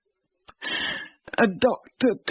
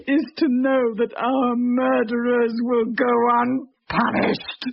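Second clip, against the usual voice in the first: normal talking voice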